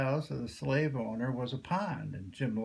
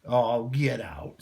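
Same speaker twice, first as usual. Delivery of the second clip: dismissive slave owner; 7 dB louder, slower than usual. A narrator's reading. Owner dismisses Jim's story.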